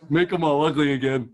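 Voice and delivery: acted helpless giggling into the words; doubled over laughing. Last man in line answering God, acted laughing fit. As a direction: get the wish out through laughter. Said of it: "the last guy who's doubled over laughing".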